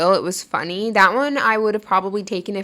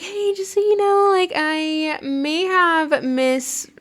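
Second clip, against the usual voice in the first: much higher, sing-song sheepish confession; sheepish sing-song admission to a friend, much higher and slow on the end; 9 semitones higher, slower than usual. Retold or imitated. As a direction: drawl the confession high and slow.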